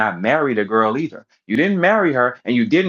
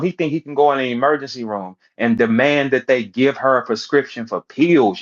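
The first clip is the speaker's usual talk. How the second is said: ordinary talk, nothing marked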